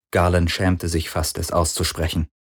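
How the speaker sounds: ordinary delivery, nothing marked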